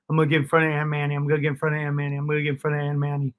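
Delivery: quick, driven self-chant; fast repeated mantra. Retold or imitated. Chanting his goal to himself.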